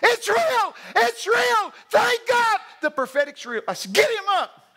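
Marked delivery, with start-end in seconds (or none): 0.0-3.6 high, full-volume astonished scream; re-enacted frantic shouting, easing at the end. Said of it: "he's screaming". 3.9-4.7 loud, high urgent command; re-enacted sharp shout; shouted order. Shouted order amid the commotion.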